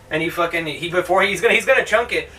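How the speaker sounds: ordinary talk, nothing marked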